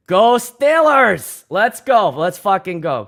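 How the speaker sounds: slow, loud, high sports cheer. Real, not acted. DJ cheering his team on stream, loud slow chant. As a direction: yell it out like a stadium chant.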